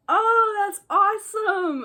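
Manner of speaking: very high, loud fake-sounding delight; suspiciously overdone high-pitched enthusiasm; odd over-bright cheer. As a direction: gush far too brightly, imitating a friend. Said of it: "why are you talking like that".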